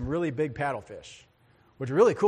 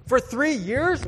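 incredulous; 8 semitones higher, 6 dB louder, slower than usual. Acted. Mock disbelief at the long wait.

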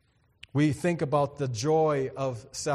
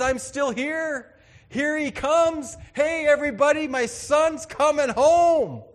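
joyful father; 9 semitones higher, 8 dB louder than usual. Acted. Father calls everybody to celebrate son's homecoming, jubilant loud call. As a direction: shout the news to the whole household.

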